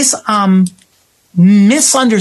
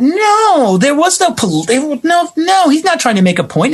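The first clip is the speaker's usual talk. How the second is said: high, lightly mocking dismissive voice; high mock denial; mocking the directors; 10 semitones higher than usual. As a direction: parody their self-justification. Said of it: "They had justified in their head".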